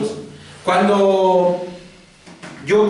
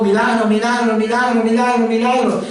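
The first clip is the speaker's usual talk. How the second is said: rising, urgent trance chant on a higher pitch; raised voice; 4 semitones higher than usual. Acted. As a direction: lift the repeated plea higher, more insistent. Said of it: "Y empieza a alzar la voz". (alzando la voz en rezo insistente)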